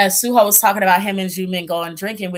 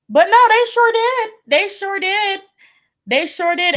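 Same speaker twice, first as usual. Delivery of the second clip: high, excited insistence; emphatic sure did; 12 semitones higher than usual. Real, not acted. Vlogger to viewers, high emphatic insistence. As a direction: keep insisting, high and delighted.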